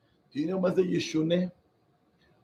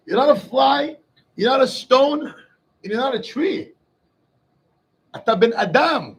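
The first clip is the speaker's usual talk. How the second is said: rising, louder sermon voice, near shouting; fiery preaching; 3 semitones higher, 7 dB louder than usual. Acted. Rabbi urging his listeners they are lucky, fiery preaching. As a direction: preach it to the crowd with fire.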